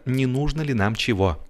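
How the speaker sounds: ordinary delivery, nothing marked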